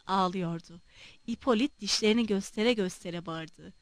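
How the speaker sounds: ordinary delivery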